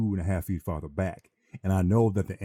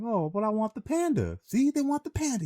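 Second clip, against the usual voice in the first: mock the carnie begging like a kid; whining carnie. Carnie pleading with the narrator as he walks away, high whine. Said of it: "he says".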